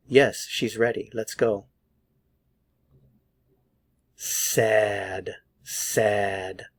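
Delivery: ordinary delivery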